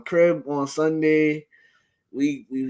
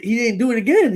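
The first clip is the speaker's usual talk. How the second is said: ordinary talk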